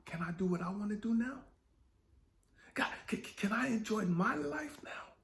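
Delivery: whispered plea. Retold or imitated. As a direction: stammer then whisper the plea high. Pleading question to God.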